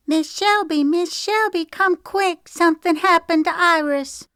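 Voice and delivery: put-on a small girl running in with urgent news; little girl voice. Little Agnes calls Shelby urgently, high child's voice.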